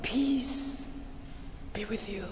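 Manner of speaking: soft words fading to a whisper; hushed blessing. Acted. Jesus greeting his disciples with peace, hushed. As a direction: breathe out the blessing, very softly.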